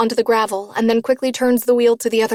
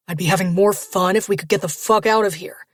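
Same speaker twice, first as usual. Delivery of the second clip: near snarl. Acted. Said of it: "Waylon almost snarls".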